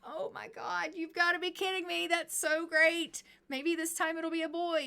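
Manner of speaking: high, bright gush of delight; excited. Acted. Husband cheering over a new baby, excited and high.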